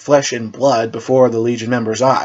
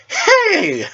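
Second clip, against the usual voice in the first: sharp, very high playful yelp; mock indignant hey. Raven protesting the knight's broom joke, playful high yelp. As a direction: squeal it in fake offence.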